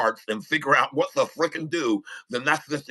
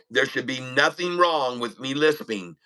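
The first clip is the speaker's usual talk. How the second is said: ordinary talk, nothing marked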